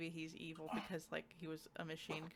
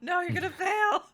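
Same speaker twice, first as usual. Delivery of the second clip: laughing; 13 semitones higher, 14 dB louder than usual. Real, not acted. Joking about failing essays.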